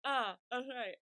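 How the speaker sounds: mock awkward realization, loud first sound; hesitant, embarrassed imitation; a little embarrassed. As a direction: act out a flustered recollection. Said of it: "he's, like, a little embarrassed".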